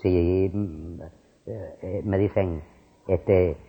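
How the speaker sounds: ordinary talk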